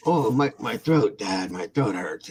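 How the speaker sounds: milk a pretend sore throat, drawn out; fake sick son. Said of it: "and he'll like".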